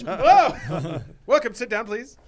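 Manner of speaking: high, loud greeting from a fake interviewer; disguised interviewer. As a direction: pipe up as a badly disguised boss welcoming a candidate. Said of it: "Puts on a fake mustache".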